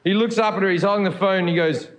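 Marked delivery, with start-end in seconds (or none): none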